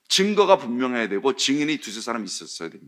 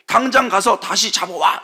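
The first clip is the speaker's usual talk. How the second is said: higher, louder barked order; 6 semitones higher, 5 dB louder than usual. Retold or imitated. Leaders ordering officers to arrest Jesus, loud barked order. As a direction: snap the order at them.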